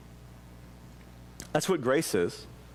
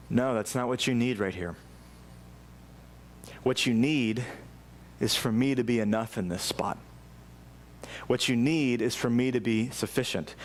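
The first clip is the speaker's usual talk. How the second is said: lower-pitched, measured voice of God; God answering gently; 5 semitones lower than usual. Acted. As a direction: answer a plea in a low, settled voice. God answering a pleading believer.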